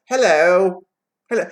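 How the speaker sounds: high, loud hello; yelling. Shown as a demonstration. Showing how the boy answered, yelling. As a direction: holler the hello upward. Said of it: "He yelled".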